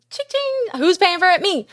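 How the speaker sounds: mock cash register. Mocking the money spent.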